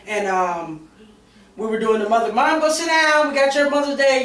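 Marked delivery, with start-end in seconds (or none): 2.3-4.2 put-on higher, eager child voice; playfully voicing the excited kids; kids calling mom. Kids' Mother's Day call retold.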